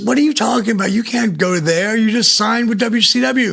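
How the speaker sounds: high-pitched retold objection; imitated incredulous wife. Wife protests in retold argument.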